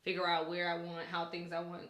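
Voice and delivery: normal talking voice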